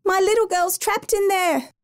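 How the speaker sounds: high, desperate cry; mother crying that her girl is trapped; panicked cry. A narrator's reading. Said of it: "Valerie yelled".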